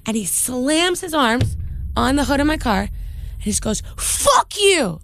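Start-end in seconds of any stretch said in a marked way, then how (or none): 4.0-5.0 high-pitched mock yell, no extra volume; imitated angry yell. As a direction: act out the driver's furious parting shot. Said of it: "like that".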